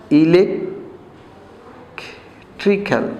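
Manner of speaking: Electrical drawn out very slowly; very slow classroom modeling of electrical; slow model word. Shown as a demonstration. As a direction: teach electrical syllable by syllable. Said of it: "আমরা adjective করব electrical".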